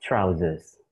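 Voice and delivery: "trousers" said as /traʊzəs/ with a falling end; teacher demo of "trousers"; model pronunciation. Shown as a demonstration. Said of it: "you should read it".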